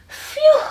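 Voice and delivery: high, loud breathless phew. Acted. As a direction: puff out a tired phew. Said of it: "he gasped".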